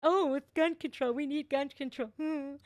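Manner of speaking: a hint of a preachy star parroting a slogan; mocking Travis Kelce. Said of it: "That's Travis Kelce's latest".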